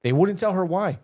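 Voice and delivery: ordinary talk, nothing marked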